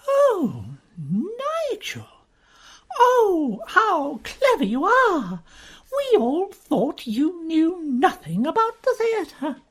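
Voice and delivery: high, slow, mock-admiring society girl; gushing young woman. Uziette mocking Nigel with high gushing praise. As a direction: coo it out sweetly, all false flattery.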